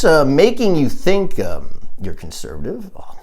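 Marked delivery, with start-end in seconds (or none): none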